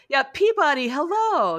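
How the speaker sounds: imitating a grinning hello, pitched high and loud; grinning greeting. Roarke greets the dazed aide, grinning greeting. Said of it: "he just grins at Peabody and says".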